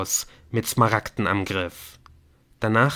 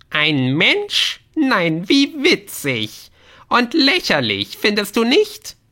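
very high, loud, drawn-out gnome voice; mocking gnome; 12 semitones higher, 6 dB louder, slower than usual. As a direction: jeer at them as a shrieky little gnome. Gnome mocks the new human. (spöttischer, hoher Gnom)

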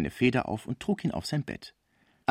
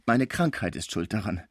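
usual speaking voice

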